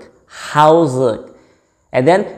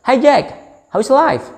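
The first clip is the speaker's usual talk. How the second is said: much higher, cheery "Hi Jack, how is life?"; friend greeting; 11 semitones higher than usual. Shown as a demonstration. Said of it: "Say, for example". (menyapa teman dengan nada tinggi ceria)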